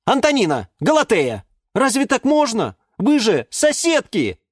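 high-pitched exasperated scolding; stern intervention. A narrator's reading. Юра scolds quarrelling plant neighbours, exasperated. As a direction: break up the fight urgently. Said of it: "быстро вмешался Юра".

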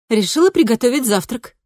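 lightly voiced cheerful teen girl; bright daughter voice. A narrator's reading. Cheerful daughter answering mother.

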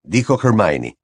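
ordinary delivery, nothing marked

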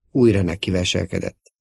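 ordinary delivery, nothing marked

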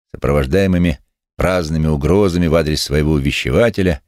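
ordinary delivery

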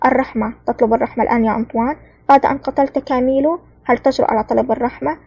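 usual speaking voice

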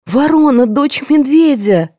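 high, slow, alarmed cry; crying out in fear; frightened exclamation. A narrator's reading. (испуганный возглас)

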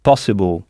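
possible in English, stress hit hard on pos, ending swallowed; English pronunciation. Shown as a demonstration. Teacher models possible in English, stress on pos. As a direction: stress the first syllable of possible. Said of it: "en insistant bien sur le pas".

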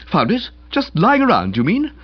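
high, rising echo question; incredulous. A narrator's reading. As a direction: query it in disbelief. Disbelief at finding a costume.

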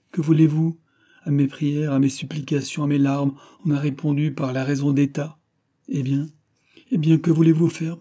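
mildly exasperated lover; exasperated young king. A narrator's reading.

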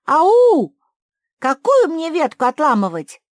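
very high, loud calling out; shouting. A narrator's reading. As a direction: call from far away. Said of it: "закричала Куки". (кричит издалека)

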